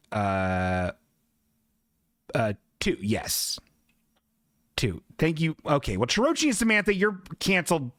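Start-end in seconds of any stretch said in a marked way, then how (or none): none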